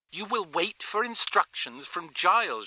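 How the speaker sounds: high, crackling woman's order over radio; urgent radio voice. A narrator's reading. Astrid ordering Tony to wait, urgent radio voice. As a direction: order it urgently through a walkie-talkie. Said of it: "crackled urgently from the receiver".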